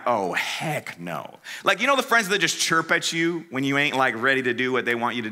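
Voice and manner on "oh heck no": much lower, slow, soft deadpan no; flat refusal. Retold or imitated. Refusing his friend's dare, low and slow. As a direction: drop it low and firm. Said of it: "I'm like".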